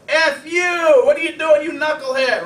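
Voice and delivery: furious yell at a driver, high and loud; road rage shout. Retold or imitated. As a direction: yell high and loud at the guy who cut you off. Driver cut off in traffic.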